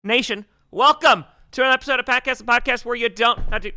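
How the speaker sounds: loud, punchy podcast host opening; show host intro. Acted. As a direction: announce it like a big show start.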